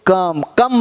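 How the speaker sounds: "कम" with a short uh vowel, pitched low; correct pronunciation. Shown as a demonstration. Teacher gives the right reading, correct pronunciation. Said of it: "इसको कोम नहीं बोलते".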